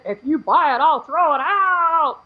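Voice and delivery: drawn-out mock demon voice, rising in pitch; imitated possessed voice. Possessed girl answering the holy water, imitated electronic voice. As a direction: lightly mimic a synthetic possessed voice, stretching the ending. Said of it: "voice turned into a man's".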